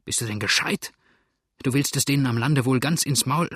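ordinary delivery, nothing marked

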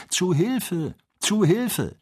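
high, desperate call from afar; cry for help. Acted. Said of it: "Worte gerufen". (hoher verzweifelter Hilferuf)

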